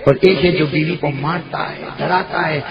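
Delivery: high, loud sermon at full force; fiery preaching. Acted. Preacher driving home the Prophet's words to the crowd, fiery.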